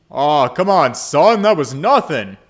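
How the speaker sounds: big, loud booming father voice; boisterous dad rallying his boy; hearty dad. Acted. Father cheerfully pushing son into a cave trip, booming loud. [name] teasing.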